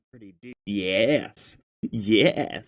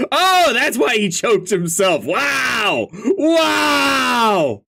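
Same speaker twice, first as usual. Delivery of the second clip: amazed; 7 semitones higher, slower than usual. Real, not acted. Reader realizing why he choked himself, high amazed outburst. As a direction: exclaim it, pitched up and drawn out.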